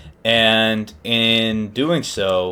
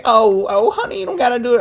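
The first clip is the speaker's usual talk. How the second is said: high, louder imitation of a pleading woman; 13 semitones higher, 4 dB louder, faster than usual. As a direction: plead shrilly as a worried wife. Wife pleads with her man.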